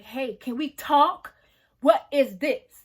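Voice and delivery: slow, loud, pointed questions; loud mock confrontation. Asking her best friend to talk, slow loud demand.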